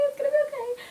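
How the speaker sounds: high, teary "okay" with a crying break; a high sobbing plea that trails off; crying reassurance. Retold or imitated. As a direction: comfort yourself through tears. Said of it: "and I'm like".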